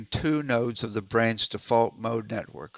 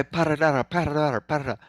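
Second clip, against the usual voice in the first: rapid-fire rolling syllables; sped up; 3 semitones higher, faster than usual. Shown as a demonstration. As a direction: rattle the syllables off as fast as they'll go. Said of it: "Speed up and just go".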